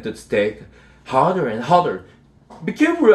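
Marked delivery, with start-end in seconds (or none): 2.6-3.1 high, urgent warning; alarmed cry. A narrator's reading. Harold warns Chester as he pounds the stake, alarmed cry. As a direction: cry out a worried warning, pitched way up. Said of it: "I cried".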